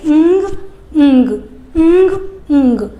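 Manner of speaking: "ंग" repeated, nasal, the g never fully released; ng drill. Shown as a demonstration. Teacher modelling ng practice for children. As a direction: chant "ंग" over and over for practice. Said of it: "थोड़ी practice करवाइए".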